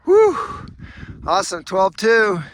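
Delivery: loud, high triumphant cheer; excited. Real, not acted. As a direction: celebrate reaching the top. Reaching the top, cheering.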